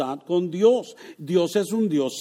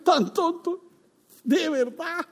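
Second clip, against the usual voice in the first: much higher, laughing sneer of the devil; mocking devil; 10 semitones higher, slower than usual. Preacher voices the devil scoffing at believers, laughing mock voice. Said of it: "el diablo se ríe y dice".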